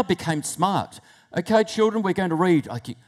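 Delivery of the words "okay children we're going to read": teacher voice. Acted. Voices the teacher addressing class.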